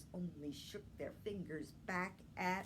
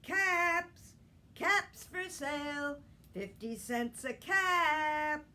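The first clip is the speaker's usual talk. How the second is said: higher, louder hawker's call; street peddler calling; 6 semitones higher, 7 dB louder, slower than usual. Acted. Peddler walking to town calling out caps for sale. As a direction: call out your wares down the street. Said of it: "he walked back to town calling".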